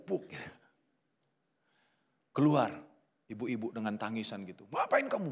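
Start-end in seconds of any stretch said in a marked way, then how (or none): 4.7-5.3 mock angry snap. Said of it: "Marah-marah dia".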